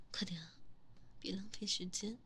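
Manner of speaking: hushed, commanding whisper. Acted. Lover gives hushed commands.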